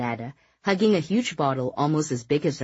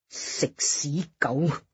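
muttered angrily; much slower than usual. Acted. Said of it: "Ah Ling muttered angrily".

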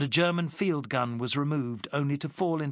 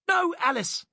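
much higher, louder cry to stop her; shouted; 5 dB louder than usual. Acted. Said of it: "shouted Fonthill".

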